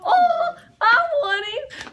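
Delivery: high, loud, half-laughing wail; mock wail. Mom retelling to class, hammed-up wail. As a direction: ham up a hurt mother's cry. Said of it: "And so I was like".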